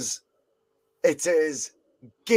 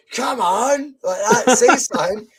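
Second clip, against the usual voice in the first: mock heckle; 7 semitones higher, 5 dB louder than usual. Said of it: "Liam's heckling him, going".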